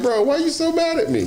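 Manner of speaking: high, whiny, puzzled question; high-pitched imitation of a confused Harden; mock Harden. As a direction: play a baffled Harden. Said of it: "Harden looks like he just sit there".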